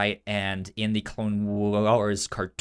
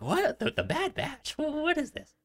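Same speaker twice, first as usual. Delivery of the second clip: mock confusion. Host mimicking co-host's confusion, high mock bewildered voice. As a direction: pitch jumps way up in playful mock bafflement.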